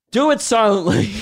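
loud, high mock teacher voice; mocking teacher shout. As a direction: jeer it back like a bratty kid.

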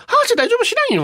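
high, lightly voiced old Okinawan grandma; a touch of old grandma; imitating grandma. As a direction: mimic grandma brushing it off. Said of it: "って言うから".

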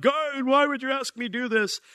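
high, mocking wail of a pining Abraham; mock anguished crying out. Preacher mocking a TV Abraham crying to God, high mock wail. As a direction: parody a tearful Abraham crying out. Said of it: "shows that show you Abraham pining and crying out to God".

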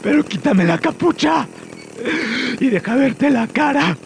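high, strained surrender; pained pleading. Acted. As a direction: give in through the pain. Prisoner gives in, still hurting.

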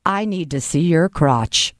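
low, solemn officer voice; mock-serious low monotone; deadpan agent. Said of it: "Just like that".

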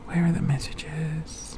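slow, absent-minded whisper; whispering to oneself. Real, not acted. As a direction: whisper while searching. Muttering to self while searching.

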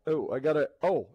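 normal talking voice

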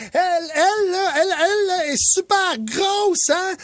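put-on high, slow little girl voice pointing something out; child pointing and shouting. Dad imitates daughter pointing at a lady in a café. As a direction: blurt it like a proud five-year-old. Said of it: "puis elle crie".

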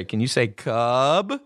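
put-on slow, careful question to a small child; slow teaching voice. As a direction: draw out the question patiently. Big brother teaching slow enunciation.

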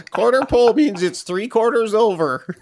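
high, laughing send-up of a co-host; mocking imitation voice. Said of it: "How come my voice changed?".

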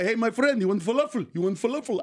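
put-on Middle Eastern street seller's accent. Falafel guy calling a passer-by friend, Middle Eastern accent. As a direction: offer food in a mock Middle Eastern accent. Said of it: "I learned the Middle Eastern accent".

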